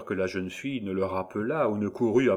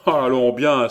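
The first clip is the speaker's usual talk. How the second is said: loud, higher burst of annoyance; acted loud outcry; exclaiming; 5 semitones higher, 10 dB louder than usual. A narrator's reading. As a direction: cry it out as an exasperated student. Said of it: "s'écria l'écolier".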